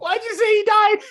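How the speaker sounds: high, loud disbelieving laugh-question; incredulous squeal. Real, not acted. Host cracking up at co-host's wrestler joke, high incredulous squeal.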